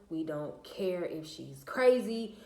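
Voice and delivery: ordinary delivery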